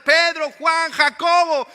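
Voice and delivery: high, very loud shouts of names; shouting. Acted. Disciples screaming to each other in the storm, shouting. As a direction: yell each name over the storm. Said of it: "los gritos de los otros discípulos gritando".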